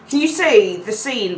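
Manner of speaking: ordinary talk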